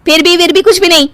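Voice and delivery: high, heated insistence; angry ultimatum. Acted. Wife threatening to go to parents, angry and high. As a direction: cut off objections and threaten to leave.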